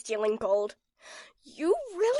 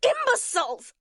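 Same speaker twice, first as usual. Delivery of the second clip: high furious cry; villainous shout; 10 semitones higher than usual. Acted. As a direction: scold the minions, high and sharp. Villain berates minions.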